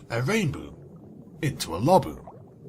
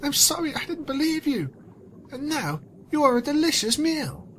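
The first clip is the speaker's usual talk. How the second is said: much higher, mock tearful voice; hammed-up sorrow; 13 semitones higher than usual. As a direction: apologise in overdone grief. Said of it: "she cried".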